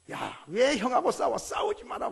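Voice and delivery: put-on sharp, loud scolding of a child, rising high; a mother telling her boys to stop fighting; mock-stern parental scold.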